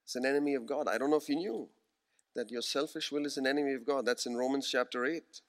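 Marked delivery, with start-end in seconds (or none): none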